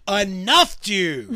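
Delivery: high, loud, exasperated command; comic fed-up yell at a ghost; retold yell. Tired lodger telling the ghost off, retold exasperated yell. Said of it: "Sometimes you yell at ghosts".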